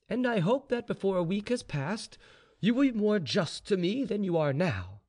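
high-voiced, self-assured informer; slave informer. A narrator's reading. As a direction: politely press your master for justice. Gregory presses the general.

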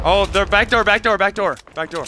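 high, loud, urgent warning; urgent callout. Real, not acted. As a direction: yell it out to the squad. Callout: enemies at back door.

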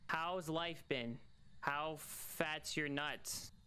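slow mock questions. Slow goofy questions to the ghost.

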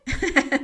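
amused chuckle; a quick real laugh at the mistake; light laugh; short laugh. Real, not acted. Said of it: "suena súper chistoso".

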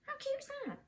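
high, soft delight. Real, not acted. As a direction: coo over how cute it is. Delighted by tiny bag.

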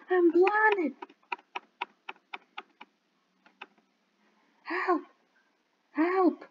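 high, hammed-up cries for help; pretend-blind panicked pleading; mock panic. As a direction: play it like a blinded damsel in distress.

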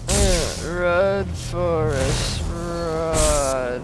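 loud, urgent, drawn-out cheer. Real, not acted. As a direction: yell at yourself to run. Urging himself on mid-race.

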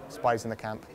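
conspiratorial hush over the words Spies in the camp; hushed aside. Retold or imitated. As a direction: murmur it like a secret warning. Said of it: "Shh, shh, shh, shh".

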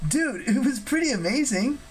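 high-pitched, admiring dude voice; amused enthusiasm. A narrator's reading. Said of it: "Percy laughed".

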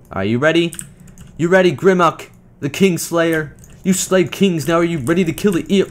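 loud hyped-up rallying call; hype. Real, not acted. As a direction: pump up the teammate. Hyping up teammate.

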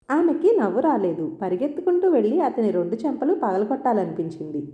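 ordinary delivery

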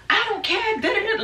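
high mock voice of the angry friend; mocking Crystal. Friend mimicked mid-fight in the club, high and sassy. Retelling Crystal's outburst, pitch jumps.

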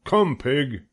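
farmer coaxing pig. A narrator's reading. Farmer coaxes pig with pail.

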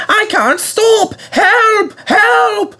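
piercingly high, drawn-out cries for help; runaway engine wailing in panic. A narrator's reading. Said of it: "he wailed".

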